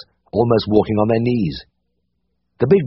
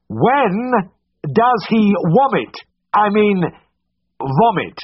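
slow, high bawling into his ear; bawled; 13 semitones higher, much slower than usual. Acted. Vet bawling the question at deaf Mulligan, slow bawl. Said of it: "and bawled".

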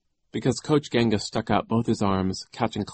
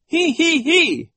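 very high, slow, loud hee-hee-hee; giggling; 8 dB louder, slower than usual. A narrator's reading. Nero shakes his pigtails in laughter, high giggle. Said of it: "shaking his pigtails in laughter".

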